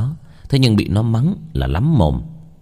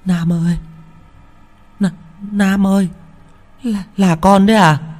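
high, frightened, stammering call; stammering in fear; 11 semitones higher than usual. Acted. Grandmother calling her grandson in the dark, stammering with fear. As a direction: call out the name, voice breaking in fright. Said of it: "kêu ú ớ".

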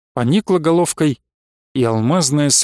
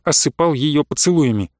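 plain reading voice

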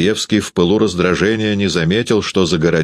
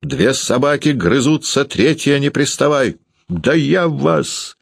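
high-pitched threat, last words drawn out; heated high-pitched outburst; angry cry; 8 semitones higher, slower than usual. A narrator's reading. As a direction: warn off an intruder, voice raised in pitch. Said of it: "подскочил к Передонову и закричал".

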